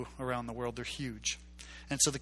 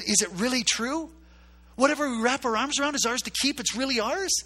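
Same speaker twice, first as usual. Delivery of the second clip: put-on high, louder childlike wonder; amazed small boy; 10 semitones higher, 5 dB louder than usual. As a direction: ask it like a stunned poor boy. Poor boy asks in wonder.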